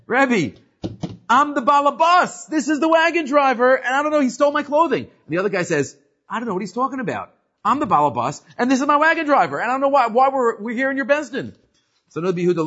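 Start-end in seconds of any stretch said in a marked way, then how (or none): 0.1-5.0 high, loud aggrieved complaint; indignant plea. Retold or imitated. Pleading to the rabbi. 6.3-11.5 indignant plea. Retold or imitated. Driver's counter-claim.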